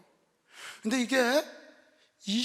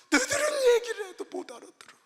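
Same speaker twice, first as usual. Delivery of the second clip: put-on weeping old mother; 6 dB louder than usual.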